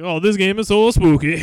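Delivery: lightly mimicking a Swedish streamer; Swedish-accented gamer imitation; mock Swedish accent. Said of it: "he had this thick accent".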